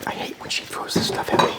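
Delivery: hushed conspiratorial whisper; whispered aside. Real, not acted. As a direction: whisper it to the camera.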